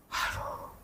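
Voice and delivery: barely voiced, breath-only "aduh"; hushed sigh. Recalling what his heart felt that evening, a hushed sigh. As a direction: whisper it as if overcome.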